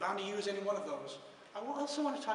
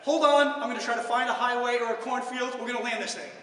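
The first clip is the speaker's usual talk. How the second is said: louder frantic young pilot, hammed-up; nervous pilot; 4 semitones higher, 10 dB louder than usual. Young pilot announcing an emergency landing, mock panic. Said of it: "nervous-sounding pilot".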